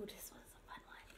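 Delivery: hushed, quick whisper; very soft, conspiratorial whisper; secretive whisper. Acted. Secretive build-up to next animal.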